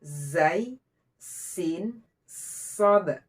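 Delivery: "Zai, sin, sod" with long, pressed sibilants; each of "Zai, sin, sod" set apart, sibilant held sharp; clear pressed hiss. Shown as a demonstration. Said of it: "Dijelaskan desisnya, ditekan".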